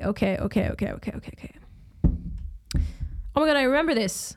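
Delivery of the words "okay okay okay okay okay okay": okays fading from soft to whispered. Shown as a demonstration. Vlogger settling down before questions, fading to a whisper. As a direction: let the okays die away to a whisper.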